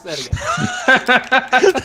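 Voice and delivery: slow, laughing shout; half-laughing shout. Pretend. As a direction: bellow it out for the game. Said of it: "Nope, that's not a shout".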